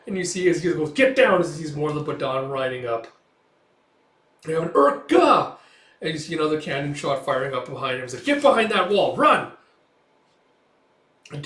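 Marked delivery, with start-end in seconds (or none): 0.9-1.4 high, loud urgent command; shouted warning. Retold or imitated. Warning as more riders approach, urgent shout. 4.7-5.6 high, loud yell; acted war shout; battle cry. Name yelled amid cannon fire, battle cry. 8.2-9.5 high, loud command to flee; urgent shouted order. Retold or imitated. Man sends others to safety under cannon fire, urgent shout.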